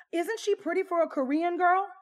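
high, loud, overdone gushing; imitated gushing question. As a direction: turn to the table, mock-gushing for agreement. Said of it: "She continued on to say".

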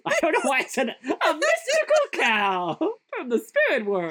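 cracking up at own line; giggling through mystical cow; laughing. Real, not acted. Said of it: "I love a good mystical cow".

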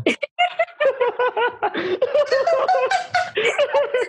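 high, long laughing fit; burst of laughter. Real, not acted. Laughing at a friend's cheesy love proverb, high giggling fit.

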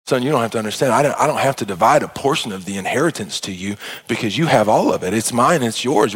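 calm the son tenderly; gentle father. Acted.

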